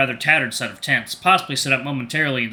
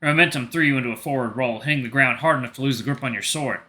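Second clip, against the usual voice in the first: ordinary talk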